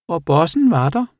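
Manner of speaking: high, loud little creature voice; animal character. A narrator's reading. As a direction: pipe it up excitedly, far above normal pitch. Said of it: "sagde Rabat". (høj, ivrig dyrestemme)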